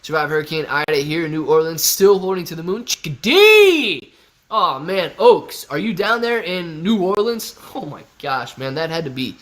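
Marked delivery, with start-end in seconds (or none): 2.8-4.1 very high, loud, stretched call of the name; a long joyful yell of a name; excited call-out. Real, not acted. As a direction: sing out Chickadee like a greeting. Calling out a viewer's name.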